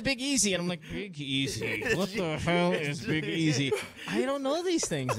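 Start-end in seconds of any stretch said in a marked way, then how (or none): 0.0-0.5 higher, breezy quoted mention; casual name-drop. Retold or imitated. Co-host imitating colleague using a nickname, casual. 0.9-1.8 low, puzzled mock repeat; baffled echo. Co-host retelling his reaction to the nickname, baffled. 1.9-3.7 slow, deliberate mock bewilderment; baffled. Co-host retelling his reaction to Big Easy, baffled.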